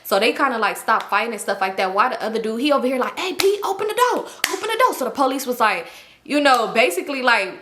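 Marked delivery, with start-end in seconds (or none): 3.2-4.9 high, urgent call through a door; imitating him pleading to be let in. Dude calling to locked door.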